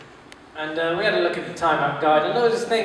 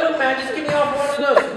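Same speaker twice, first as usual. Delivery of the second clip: higher-pitched annoyed tramp; getting annoyed; 7 semitones higher than usual. Acted. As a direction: haggle with growing annoyance. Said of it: "he's getting annoyed".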